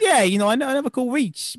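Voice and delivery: put-on loud comic Jersey accent; mock Jersey accent. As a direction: play Dante in an overdone Jersey accent. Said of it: "Why are you giving them Italian accents?".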